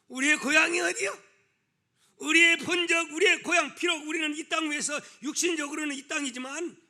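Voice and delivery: high, loud sermon questioning; full-force preaching. Real, not acted. Preacher asking believers where their true home is, full-force preaching. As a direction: ring the question out to the congregation.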